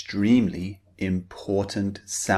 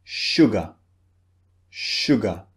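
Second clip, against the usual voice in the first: "sugar" stressed on the first syllable, ending in a light "uh"; unstressed second syllable. Shown as a demonstration. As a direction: hit the start of "sugar", let the second syllable fall. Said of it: "It's the second syllable".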